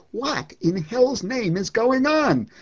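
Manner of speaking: slow, loud bewildered exclamation; exasperated outburst. Acted. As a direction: throw it out at the world in bewildered anger. Poem line, exclaimed.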